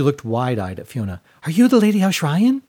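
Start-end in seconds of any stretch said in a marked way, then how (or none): 1.4-2.6 put-on much higher, louder small girl's voice; high breathy girl. A narrator's reading. Small girl greeting Fiona, high and eager. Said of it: "high, breathy voice".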